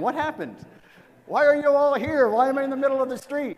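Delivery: high, loud, fast panicked questions; bewildered young man. Retold or imitated. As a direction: blurt it out, waking up lost and alarmed. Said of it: "I'm picturing the young, young man".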